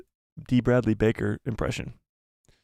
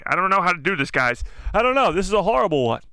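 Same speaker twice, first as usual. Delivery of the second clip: higher and louder, laughing frustration; flustered outburst; 6 semitones higher, 4 dB louder than usual. Real, not acted. Streamer gives up on the impression, flustered and loud. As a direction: blurt it out, exasperated at yourself.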